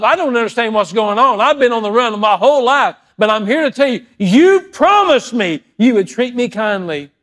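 high, loud, impassioned plea to God; pleading shout. Acted. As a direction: cry out to God, holding Him to His promise. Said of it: "Jacob said".

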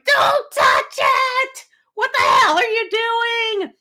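very high, slow panicked shriek; panicked owner shrieking at her dog to leave the rat; freaking out. Retold or imitated. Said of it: "I'm really freaking out, going".

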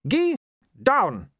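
barked like at a dog; sharp command. A narrator's reading. Wife ordering husband down, sharp loud command.

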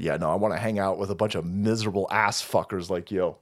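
usual speaking voice

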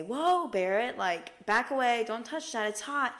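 alarmed mom. Retold or imitated. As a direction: warn a small child off sharply. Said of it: "my mom is gonna say".

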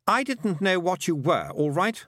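put-on high boy's voice, defensive; boy character, defensive. A narrator's reading. Roland excusing himself to the girl, high and defensive.

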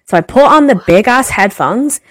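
ordinary talk

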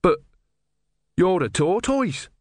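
put-on simple, wide-eyed young monk; naive young novice. Brother objects.

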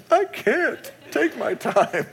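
high, slow, laughing mock wail. Father to his wife at dorm goodbye, high slow laughing mock wail. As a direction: whimper it out like a crying dad who can't hold it together. Crying father answers wife's suggestion.

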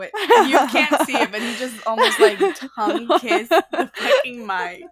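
talking through laughter; laughing. Real, not acted. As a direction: giggle through the words. Laughing at partner.

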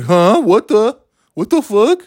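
high, rising disbelief; incredulous. Retold or imitated. Driver with hands up at old lady, high incredulous. Mimes outrage, hands up in car.

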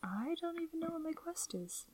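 high airy whisper. Real, not acted. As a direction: whisper it like a confession. Whispered admission.

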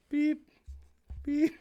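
mock scanner beep. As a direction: chirp like a store scanner. Scanner noise in role play.

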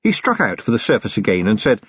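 ordinary delivery, nothing marked

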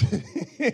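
helpless breaking-up laugh; short bubbling laugh. Real, not acted. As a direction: crack up mid-sentence. Breaks up mid-sentence.

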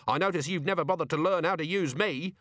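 high-pitched, testy retort; snapped. A narrator's reading. Vimes turning the demon's complaint on it, snappish. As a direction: throw the accusation back testily. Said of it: "snapped Vimes".

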